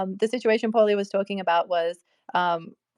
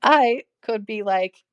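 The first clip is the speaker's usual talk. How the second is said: normal talking voice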